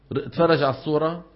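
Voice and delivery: ordinary delivery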